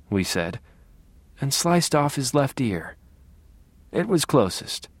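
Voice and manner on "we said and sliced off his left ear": ordinary delivery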